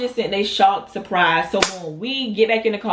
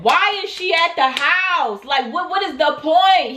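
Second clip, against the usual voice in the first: higher, slower, insistent questioning; exasperated demand; 6 semitones higher than usual. Retold or imitated. Storyteller confronting Demon about the mistress, exasperated. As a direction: press the question in rising exasperation. Acted argument with the boyfriend.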